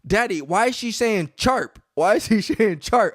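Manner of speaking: put-on high, slow little-kid voice, puzzled; a son asking his dad about a word; child's question. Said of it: "my son is like".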